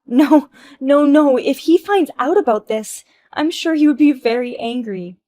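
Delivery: high, loud frantic denial; panicked. Acted. Wife panicking that her husband will find out. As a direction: plead in a panic.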